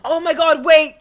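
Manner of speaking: 2 loud, sudden call; 3 cut everyone off with it; 1 excited shout. Real, not acted. 4 streamer spotting a good chat idea, loud and excited.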